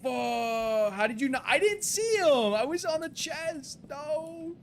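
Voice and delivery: high, loud, frantic "no, no, no"; real alarm, yelling at the screen; panicked shouting. Real, not acted. Streamer sees the pet escape, panicked high shout.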